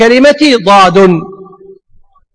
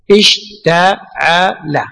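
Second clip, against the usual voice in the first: slow, drawn out; 5 semitones lower, 4 dB quieter, much slower than usual. Shown as a demonstration. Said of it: "صح؟".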